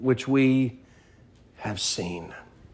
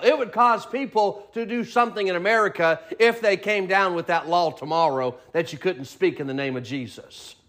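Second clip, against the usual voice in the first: normal talking voice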